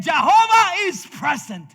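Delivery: loud exultant proclamation; joyful cry. Retold or imitated. Israelites watching the Red Sea close, loud exultant cry. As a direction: shout it as if you just saw the sea close. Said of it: "and they said".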